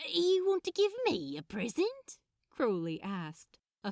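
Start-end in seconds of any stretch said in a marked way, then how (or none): 0.1-2.2 high, loud thrilled question; light up at the idea; surprised delight. Acted. Crowley thrilled Aziraphale offers him a present.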